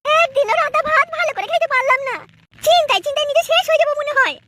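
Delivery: put-on lamenting young wife. Wife laments to herself about her husband, high and complaining.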